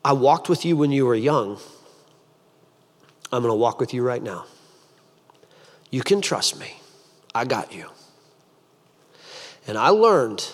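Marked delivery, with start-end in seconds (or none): 0.0-7.9 lower, softer, unhurried comfort; gentle reassurance. Acted. God comforts a scared patient, gentle reassurance. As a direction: reassure softly, with long pauses. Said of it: "God said to me".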